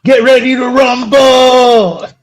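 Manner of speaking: drawn-out, booming ring announcer. Pretend. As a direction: stretch it like a boxing announcer. Wrestling announcer catchphrase.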